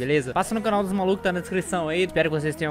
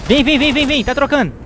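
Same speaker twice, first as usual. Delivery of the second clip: excited shouting; 9 semitones higher, 9 dB louder than usual. Real, not acted. Urgent calling to teammates.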